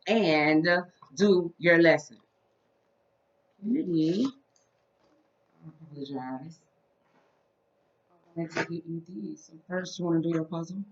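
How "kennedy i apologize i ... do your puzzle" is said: put-on soft, gentle voice for a small child; reading to a toddler. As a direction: coax a toddler softly. Talks softly to toddler.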